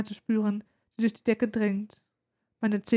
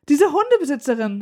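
higher, louder indignant complaint; worked-up mother; 4 semitones higher, 6 dB louder than usual. A narrator's reading. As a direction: fume about someone, getting worked up. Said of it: "regt sich meine Mutter weiter auf".

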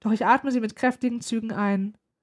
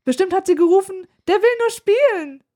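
much higher, louder, scornful imitation; indignant mocking mother; 9 semitones higher, 7 dB louder than usual. A narrator's reading. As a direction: get worked up, mimicking the dog owner. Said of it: "regt sich meine Mutter weiter auf".

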